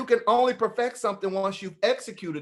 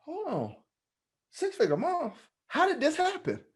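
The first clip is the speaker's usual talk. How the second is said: disbelief; 11 semitones higher than usual. Retold or imitated. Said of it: "And I'm like".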